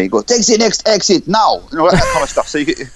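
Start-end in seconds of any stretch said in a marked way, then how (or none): 0.2-1.6 high, slow German sat-nav voice; German accent. Acted. Sat nav directing a driver in Germany, high German accent. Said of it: "say you're driving in Germany".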